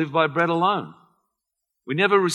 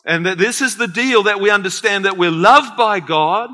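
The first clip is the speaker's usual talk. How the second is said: rising, louder preacher's call; preaching at full force; 5 semitones higher, 6 dB louder than usual. Acted. Pastor preaching to the church, raised and louder. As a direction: drive it home to the congregation.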